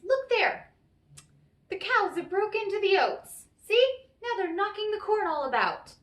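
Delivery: high, loud, sharp leprechaun scolding. Acted. The leprechaun tricks Tom by pointing at the cows, sharp and loud. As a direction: snap at the pest to look at his fields. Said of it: "answered Tom sharply".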